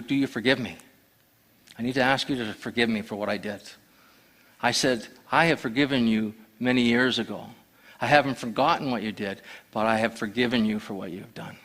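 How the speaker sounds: a hushed, tender exchange at night; quiet and sincere. Retold or imitated. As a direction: say it gently, like finally letting go.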